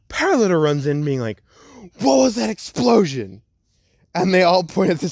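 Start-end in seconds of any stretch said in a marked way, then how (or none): 2.0-3.4 high-pitched acted alarm; startled, pitched-up outcry; alarmed question. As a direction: burst in demanding to know. Acted character runs in alarmed.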